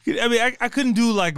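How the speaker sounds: ordinary delivery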